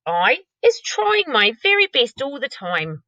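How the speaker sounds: lightly voiced friendly giant, pleading; a gentle giant trying hard; hint of a sad friendly giant; BFG voice. BFG voice in read-aloud.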